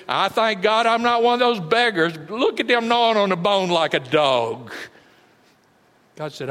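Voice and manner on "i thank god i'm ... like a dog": sneering, louder voice of the smug rich man; mocking rich man. Preacher voicing the smug rich man sneering at beggars. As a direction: scoff at the beggars from on high. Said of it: "you used to laugh at them".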